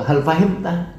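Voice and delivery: "Hal fahimta" as a question awaiting yes or no; rising question intonation. Shown as a demonstration. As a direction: ask "Hal fahimta" with pitch going up. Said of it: "intonasinya naik".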